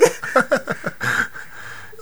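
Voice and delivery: breathy wheezing laugh; unvoiced high laugh. Real, not acted. Laughing after story.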